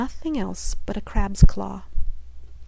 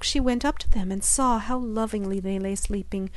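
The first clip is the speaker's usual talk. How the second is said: ordinary delivery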